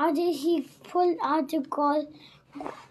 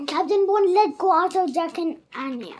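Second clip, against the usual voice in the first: ordinary delivery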